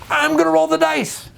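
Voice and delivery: very high, slow, gleeful dice roller; a player who won't wait, drawing it out; eager murder hobo. Retold or imitated. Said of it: "murder hobo".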